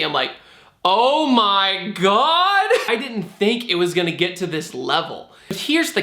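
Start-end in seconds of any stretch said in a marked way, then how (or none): 0.8-2.7 drawn-out, higher-pitched disbelief; mock shock. Student hears talk of pressing charges, exaggerated slow shock. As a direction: stretch it out in hammed-up alarm. Acted shock at lawsuit news.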